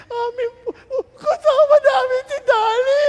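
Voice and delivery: put-on high, loud, sobbing child's wail; crying shout. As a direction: wail it like a child losing a pet. (humahagulgol na sigaw ng bata)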